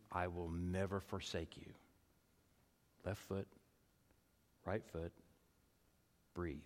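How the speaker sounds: very soft, low, with long pauses; hushed pastoral reflection. Acted. Preacher leads congregation through a coach's mantra and breath, hushed. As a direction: guide them gently, pausing after each short phrase.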